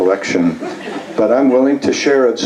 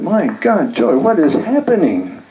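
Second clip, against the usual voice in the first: higher, swooping cry of disbelief; retold exclamation with big rises and falls; mock astonishment; 8 semitones higher than usual. Husband calling his wife in about catalogs, mock astonished.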